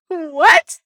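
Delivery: high, loud burst of disbelief; incredulous. Acted. Ex-employee hearing of rehire eligibility, incredulous. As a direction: blurt it out, pitch shooting up.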